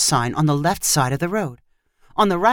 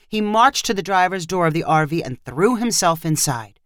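plain reading voice

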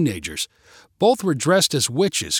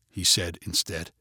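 ordinary delivery

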